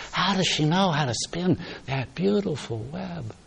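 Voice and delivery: high-pitched, hushed wonder; imitated playful delight at tiny creatures; bright, playful. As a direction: marvel like an enchanted old man. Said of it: "bright, playful spirit".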